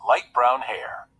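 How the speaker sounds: plain reading voice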